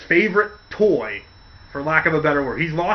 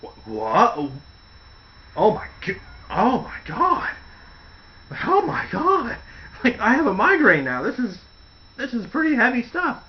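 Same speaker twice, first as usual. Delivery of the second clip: stunned disbelief; 6 semitones higher than usual. Retold or imitated. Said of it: "and he's like".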